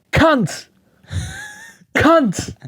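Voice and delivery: high-pitched one-word heckle; retold heckler's shout. Guy at back of crowd heckling the comic, high mock shout. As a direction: imitate a heckler calling out from the back. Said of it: "the one shouting that".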